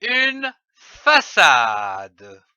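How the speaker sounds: "une façade" drawn out very slowly; slow teaching voice. Shown as a demonstration. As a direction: stretch "une façade" for young readers.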